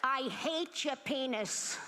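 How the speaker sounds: lightly voiced put-down granny; nagging grandmother. Grandmother puts her husband down, lightly mocked nagging. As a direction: imitate grandma scolding, slow and drawn out. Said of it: "she would just put him down".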